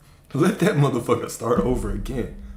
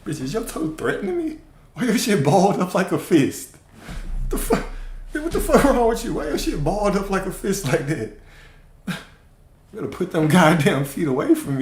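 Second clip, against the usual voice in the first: mock outrage; 7 semitones higher than usual. Mock-scolding a toe.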